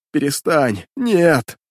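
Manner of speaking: put-on desperate young voice cracking on a shout; plaintive breaking cry. A narrator's reading. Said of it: "Жалобно, потерянно ... юношески срывающимся голосом закричал". (жалобный крик срывающимся юношеским голосом)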